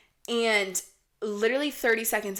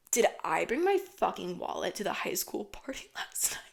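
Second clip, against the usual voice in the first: hushed, guilty whisper with a small laugh; whispered realization; 6 dB quieter than usual. Retold or imitated. Girl searching for her wallet realizes she left it at the party, whispering. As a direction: whisper it like a sudden dawning dread.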